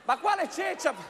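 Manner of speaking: exasperated. Acted. Anchor exasperated.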